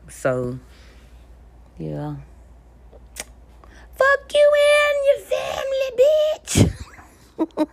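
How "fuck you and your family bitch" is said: put-on very high, loud little-girl scream; screaming meme girl. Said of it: "she screams and yells in the fucking camera".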